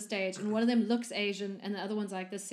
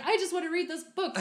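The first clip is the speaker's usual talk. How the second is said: mock Jafar; 9 semitones higher, 3 dB louder than usual. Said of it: "he's like".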